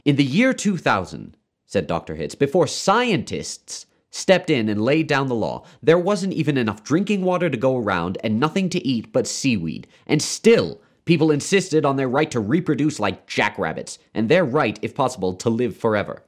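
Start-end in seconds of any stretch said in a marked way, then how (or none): 0.0-1.4 high, emphatic opening of a harangue; insistent, raised voice; impassioned lecturing. A narrator's reading. Doctor harangues the father. 2.4-16.2 high, indignant harangue; emphatic, high-pitched tirade; impassioned lecturing. A narrator's reading. Doctor harangues the father.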